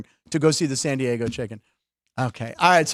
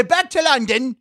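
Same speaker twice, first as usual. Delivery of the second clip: "Back to London." raised high in a hammed-up English accent; cheeky English accent, pitched up high; mock English accent; 8 semitones higher, slower than usual. Shown as a demonstration. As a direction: show him how an English accent goes on "Back to London.". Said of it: "Let me hear you do an English accent".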